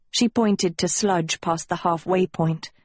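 ordinary delivery, nothing marked

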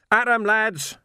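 high, loud battle call; rally the men with a shout; yelled rallying cry. A narrator's reading. Vimes to his men on the barricade, yelling. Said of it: "he yelled".

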